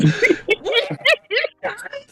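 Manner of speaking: high, helpless laugh; real burst of laughter. Real, not acted. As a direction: crack up for real. Player cracks up at joke.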